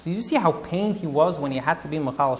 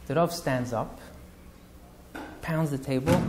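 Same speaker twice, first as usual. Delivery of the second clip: ordinary delivery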